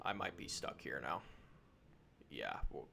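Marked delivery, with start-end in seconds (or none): 2.3-2.9 much lower, soft self-reassurance; calm yourself down in a low murmur; reassuring. Real, not acted. Stuck in a mine, reassuring himself.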